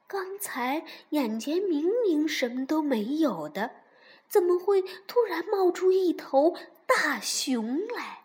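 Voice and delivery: usual speaking voice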